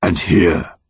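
lightly voiced measured monster; a hint of an ancient tree speaking; yew tree monster. A narrator's reading. Yew tree behind Connor, lightly voiced solemn monster.

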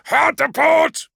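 slow, high, full-throated command; loud battle shout; shouted order. A narrator's reading. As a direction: bellow the helm order, stretching it out. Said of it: "shouted Wayland".

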